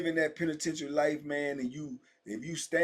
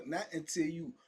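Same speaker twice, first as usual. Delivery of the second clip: usual speaking voice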